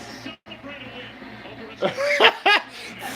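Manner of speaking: long high cackle; helpless burst of laughter; hysterical laugh. Real, not acted. Fan stunned by last-play fumble, hysterical laughing.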